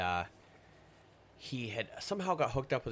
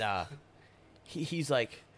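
normal talking voice

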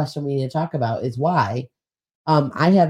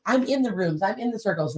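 usual speaking voice

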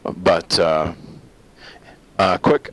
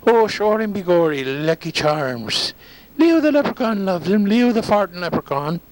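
high-pitched stage-Irish leprechaun; mock Irish brogue, pitched up; hammed-up Irish; 11 semitones higher than usual. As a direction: lilt it like a cartoon Irish leprechaun. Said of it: "He was an Irishman".

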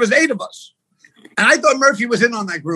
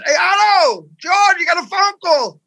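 higher, drawn-out holler; acted yell; 7 semitones higher, slower than usual. Guy at the pay phone calls Otto to the phone, acted yell. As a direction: call out to someone down the block. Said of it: "Yell out".

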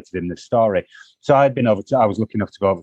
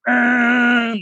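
pilot's groan; 13 semitones higher, 3 dB louder than usual. Retold or imitated. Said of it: "and he goes".

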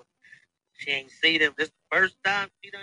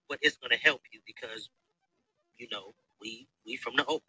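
ordinary talk

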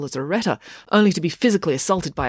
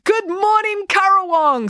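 very high, loud, drawn-out crowd greeting; happy greeting; 5 dB louder, slower than usual. A narrator's reading. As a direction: call out happily to a whole crowd. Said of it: "said the woman happily".

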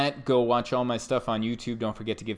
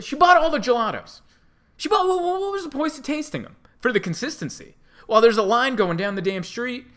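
loud, much higher, furious venting; heated rant; 8 semitones higher, 4 dB louder than usual. Real, not acted. Comic ranting at the gelato customer, loud and furious. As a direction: blow up about her holding up the line. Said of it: "I didn't realize I was gonna get that heated".

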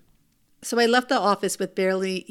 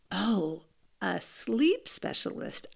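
dawning realization; 5 dB quieter, slower than usual. Retold or imitated. Realizing a new kind of doctor.

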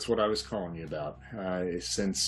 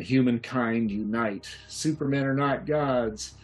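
raised, rallying protest cries; protesters shouting; 6 semitones higher, 4 dB louder than usual. Acted. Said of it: "shouting things like".

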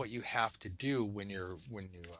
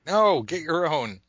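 loud, high, drawn-out mock yell; hammed-up exasperated shout; exasperated mock refusal; 6 semitones higher, 11 dB louder than usual. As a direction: holler the refusal slowly in playful outrage. Acts out reply to texters.

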